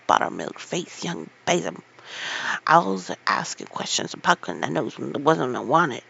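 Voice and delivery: put-on loud, raging old gardener. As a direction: rage at her from the ladder. Ben's raging rant.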